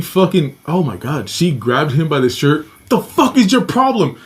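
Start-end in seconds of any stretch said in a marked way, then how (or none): 2.9-4.2 high-pitched outburst, retold; mom losing it. Mom confronting the man who grabbed her boy, losing it. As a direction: imitate an enraged mother confronting someone. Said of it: "My mom lost her shit".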